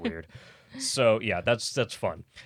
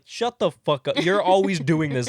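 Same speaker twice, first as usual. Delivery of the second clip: mock-angry dad, raised voice; exasperated father; 4 semitones higher, 4 dB louder than usual. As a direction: cut her off like a fed-up father. Father snaps back at daughter.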